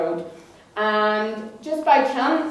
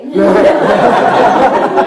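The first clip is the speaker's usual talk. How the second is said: loud laugh; 12 dB louder than usual. Real, not acted. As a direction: burst of real laughter. Laughs at robber mother.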